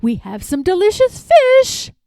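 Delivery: high, loud coaxing nurse voice; sing-song nurse. A narrator's reading. Nurse calling the girl to supper, bright and loud. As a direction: coax a child to supper. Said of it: "called a nurse".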